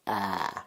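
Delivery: "ää" held long, the open a of tank; drawn-out vowel. Shown as a demonstration. Teacher demonstrating the dotted A, "ää" held long. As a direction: stretch "ää" as one long front vowel. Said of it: "is pronounced".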